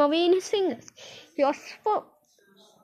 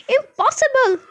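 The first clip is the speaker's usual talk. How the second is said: much higher, louder exclamation; incredulous cry; 13 semitones higher, 7 dB louder than usual. Acted. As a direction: burst out in loud disbelief. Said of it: "cried Mike T".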